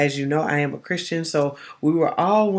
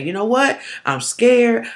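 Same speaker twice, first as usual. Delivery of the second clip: admitting fear; 7 semitones higher, 4 dB louder, slower than usual. Retold or imitated. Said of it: "come to you and say".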